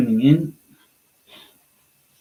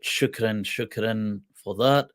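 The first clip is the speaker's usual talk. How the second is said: ordinary delivery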